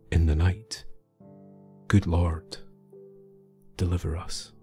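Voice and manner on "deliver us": ordinary delivery, nothing marked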